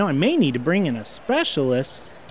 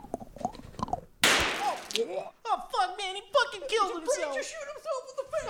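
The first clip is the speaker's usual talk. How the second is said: real laughter through the words; laughing; 6 dB quieter, slower than usual. Real, not acted. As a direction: laugh while you talk. Laughing at the sketch ending.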